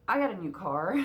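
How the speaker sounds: loud, excited announcement. Real, not acted. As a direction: share the big news with excitement.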